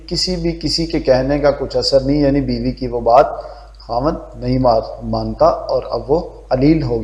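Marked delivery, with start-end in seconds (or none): none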